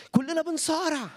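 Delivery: sudden high exclamation; preacher crying out that everyone struggles; emphatic cry. Real, not acted. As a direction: burst out with it.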